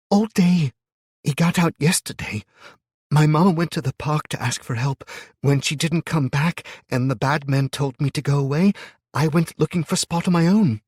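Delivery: put-on high, loud child's voice near tears; a frightened kid pouring out her story; upset little girl. A narrator's reading. Child tells of lost mother.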